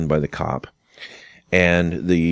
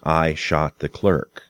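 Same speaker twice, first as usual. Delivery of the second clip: "I shot the clerk." slow and level, as a statement; confession; slower than usual. Shown as a demonstration. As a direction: read "I shot the clerk." slowly, like an admission of guilt. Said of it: "It becomes a confession".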